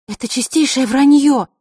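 indignant, high and loud; flaring up. Acted. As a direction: burst out in outrage. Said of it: "вспыхнула Василиса".

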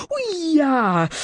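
high drawn-out yelp; howl of pain. A narrator's reading. Bully bitten on the backside by dragon, howling. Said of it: "взвыл Песьедух".

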